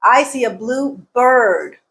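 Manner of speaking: "blue bird" slowly as two words, bird stressed; slow down and give "bird" a full beat; strong vowel on bird. Shown as a demonstration. Teacher showing a phrase, stress on bird. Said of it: "it gets that strong vowel sound".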